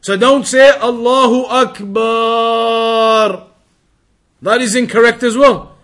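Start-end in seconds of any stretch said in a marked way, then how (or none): 0.7-3.5 Akbar with the ba sound held long, said slow; stretched ba, wrong. Shown as a demonstration. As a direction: drag out the b vowel in Akbar. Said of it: "Don't extend the ba".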